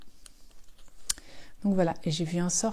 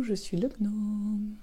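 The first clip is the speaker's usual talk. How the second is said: gnome voice; 6 dB quieter, much slower than usual. Pretend. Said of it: "Si je vous fais la main gnome".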